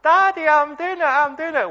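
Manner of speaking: very high, loud mock-child shout; thrilled kid's squeal, full volume; jubilant child. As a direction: imitate a little girl crowing to Daddy. Daughter shouts triumph to father.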